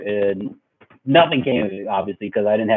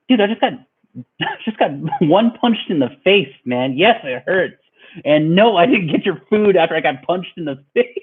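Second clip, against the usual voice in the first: higher voice with laughter breaking in; exasperated mock protest; 6 semitones higher than usual. Bloodied kid answering friends about the food, laughing exasperation. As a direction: protest in disbelief, half laughing.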